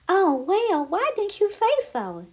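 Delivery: high, light reply; chirpy bird character. Acted. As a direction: pipe it up like a fussy little bird. Addie replies in bird voice.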